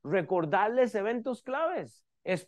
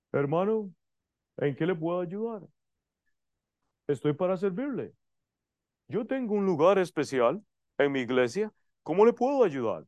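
lightly voiced courteous minister; mock welcoming preacher. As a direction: imitate a preacher greeting a visitor politely. Imitates an aloof preacher welcoming.

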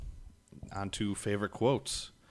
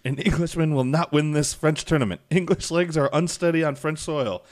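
mock French squire taunt; 7 semitones higher, 6 dB louder than usual. French squire trash-talking, quoted.

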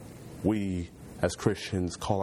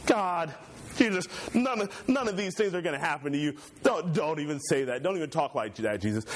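higher, pleading protest, lightly voiced; Peter protesting; 8 semitones higher than usual. Peter urging Jesus not to speak of dying, high alarmed protest.

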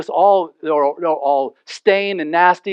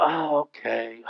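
reluctant sigh; 3 semitones lower, 7 dB quieter, slower than usual. Retold or imitated. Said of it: "You don't go".

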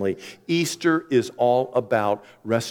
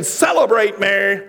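demanding; 9 semitones higher, 6 dB louder than usual. Retold or imitated. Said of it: "walk in saying".

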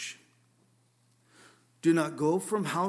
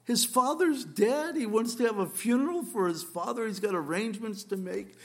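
incredulous objector; 5 semitones higher than usual. Pretend. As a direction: plead the skeptic's case, pitch up, slowed. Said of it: "you say".

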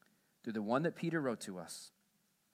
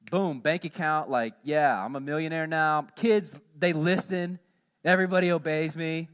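louder, sarcastic boast; mock triumphant; 3 semitones higher, 9 dB louder than usual. Pastor mocking the idea that faith brings riches, sarcastic. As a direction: send up the dream of instant riches.

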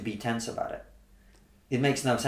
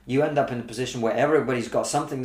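ordinary talk

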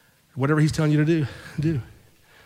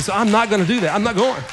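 higher, louder imitation of a man refusing; mock stubborn refusal; 7 semitones higher, 5 dB louder than usual. Husband tells his wife he won't go to work, mock stubborn. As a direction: play him digging in, voice raised.

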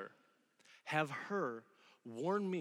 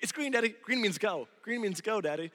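put-on higher, eager little-kid voice; imitating his toddler; 6 semitones higher, 5 dB louder than usual. Dad mimics his son copying mom, childlike. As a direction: chirp it like a three-year-old in a car seat. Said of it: "And so he'll be like".

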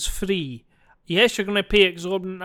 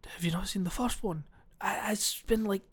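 hushed, breathy cinema whisper; whispered aside; 10 dB quieter than usual. Retold or imitated. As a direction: whisper so the row behind can't hear.

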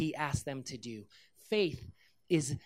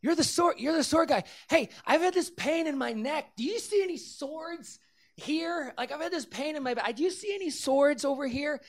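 much higher-pitched eager crowd voices; excited onlookers; 11 semitones higher, 5 dB louder than usual. Retold or imitated. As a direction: imitate people clamouring for the sword guy. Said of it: "there were people like".

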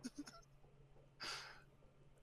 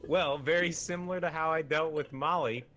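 mock diplomat; 5 semitones higher than usual. Delegate answering the mock crisis, higher and measured. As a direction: play a calm delegate at the council. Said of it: "a mock scenario".

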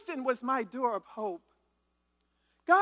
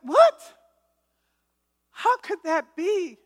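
loud, high-pitched re-enacted shock; shocked disbelief; 6 semitones higher, 7 dB louder, slower than usual. Mother retelling the police call about her daughter, shocked disbelief. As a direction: burst out high, then draw the question out. Retold reaction to daughter's death news.

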